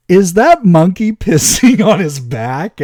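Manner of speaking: loud, high, slow shocked question; wife in disbelief. Retold or imitated. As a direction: imitate the wife's shrieking disbelief. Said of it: "And she goes".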